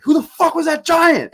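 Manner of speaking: very high, stunned outburst; astonished. Retold or imitated. Asking friends about the giant, a high stunned outburst. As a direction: gasp it like you just saw a monster. Asking after meeting Matt.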